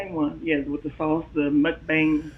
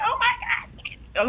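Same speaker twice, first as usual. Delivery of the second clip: mock starstruck gasp; much slower than usual. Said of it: "I don't want nobody to be like".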